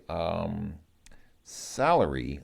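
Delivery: ordinary talk, nothing marked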